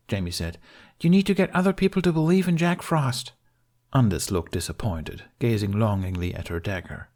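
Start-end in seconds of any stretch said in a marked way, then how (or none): 1.0-3.3 put-on higher boyish voice; young boy voice. A narrator's reading. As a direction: explain it like a determined kid. Jamie answers the kids.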